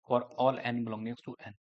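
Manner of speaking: slow, word by word dictation pace. Shown as a demonstration. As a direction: dictate it so students can write it down. Dictation continues. (धीमे-धीमे लिखवाने वाला अंदाज़)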